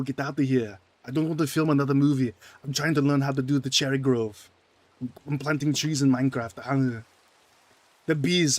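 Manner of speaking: put-on loud Austrian accent, growly action hero; Schwarzenegger impression. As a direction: Austrian action star whining about Minecraft. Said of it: "Schwarzenegger plays Minecraft".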